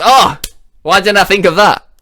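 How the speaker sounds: light up at the idea; delighted realization. Acted. Dan thanking the manager for the tip, delighted and loud.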